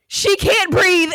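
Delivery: high, slow, alarmed cry for help; panicked friend. Retold or imitated. Friend begging the nurses for help, alarmed and high. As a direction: friend calls out in alarm that she can't breathe.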